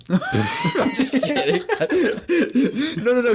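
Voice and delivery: high laughing squeal. Real, not acted. Dropping the creepy voice, laughing high. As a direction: burst out laughing.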